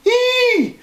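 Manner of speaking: piercing high shriek of fright; girls' shriek. A narrator's reading. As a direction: shriek like you've seen a ghost. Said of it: "both girls screamed".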